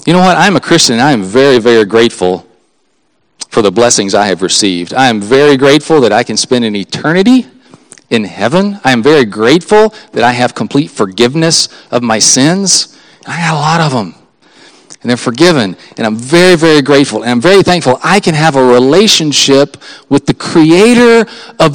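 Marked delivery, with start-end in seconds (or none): none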